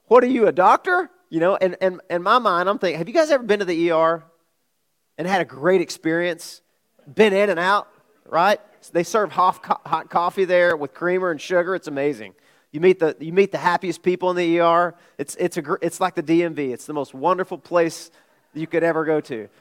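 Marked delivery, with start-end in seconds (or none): 0.1-1.1 loud, high-pitched indignant comeback; mock outrage, retelling your own outburst; angry retort. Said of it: "I got angry". 3.0-19.5 sarcasm. Acted. Sarcastic rant praising the ER.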